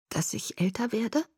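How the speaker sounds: faint, uncertain reply; unsteady voice. A narrator's reading. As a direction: answer timidly, not quite firm. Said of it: "meine Stimme war nicht so fest".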